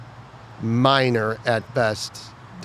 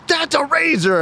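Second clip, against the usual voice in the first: very high mock yell, louder; retold yell; 5 dB louder, slower than usual. Drill instructor yelling at a recruit, high mock shriek. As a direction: shriek it in his face. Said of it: "she just yells at me".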